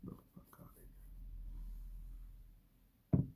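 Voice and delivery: breathy whisper; incredulous whispered dismissal; whispered thought. Retold or imitated. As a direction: whisper it under your breath. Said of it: "And I thought".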